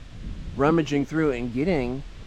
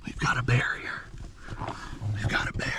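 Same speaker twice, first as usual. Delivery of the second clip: whispering; 6 dB quieter than usual. Real, not acted. Night, bear found near camp.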